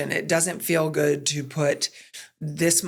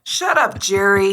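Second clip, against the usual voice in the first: playful yell; 5 dB louder than usual. Retold or imitated. Mom yelling to daughter in the other room, playful shout. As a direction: shout it across the house, half-joking. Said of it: "I'll yell".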